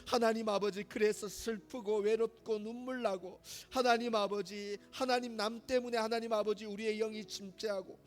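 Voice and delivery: pleading prayer, rising to full force; fervent prayer. Acted. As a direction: cry out to God in full-voiced repentance. Fervent group prayer builds.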